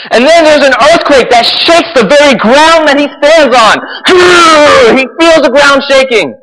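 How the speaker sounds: high, loud amazed preaching; excited preaching. Real, not acted. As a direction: tell it like it's the wildest thing ever. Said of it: "I cannot shout loud enough".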